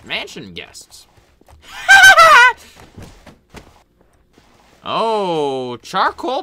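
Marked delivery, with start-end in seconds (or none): 1.7-2.5 very high loud cackle; evil cackle. Acted. Doctor cackles before greeting Charcoal Bob, loud and high. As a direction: laugh like a madman. Villain laugh.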